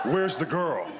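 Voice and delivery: hint of a vengeful movie father; Liam Neeson menace. Mom confronting uncle, mock Liam Neeson menace. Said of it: "Liam Neeson in her".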